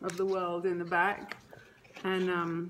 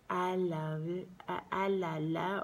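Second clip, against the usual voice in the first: put-on soft, drawn-out baby-talk singsong; toddler's lullaby babble; 4 dB quieter than usual. Mother showing how her daughter said it, soft toddler babble. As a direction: imitate a little one chanting gently. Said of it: "she was saying it kind of like".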